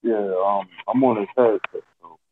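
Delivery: slightly muffled headset voice; a bit muffled, as if through a wall. Real, not acted. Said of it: "you still sound a little muffled".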